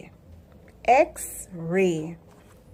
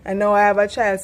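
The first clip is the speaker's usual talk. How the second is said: ordinary talk, nothing marked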